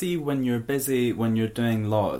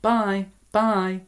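sing-song phone goodbye; 10 semitones higher, 4 dB louder, much slower than usual. Retold or imitated. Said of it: "It's like".

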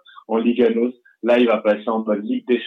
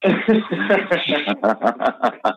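laugh; 5 semitones higher than usual. Real, not acted. Laughs at the joke.

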